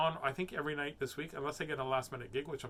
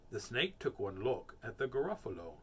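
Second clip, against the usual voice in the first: plain reading voice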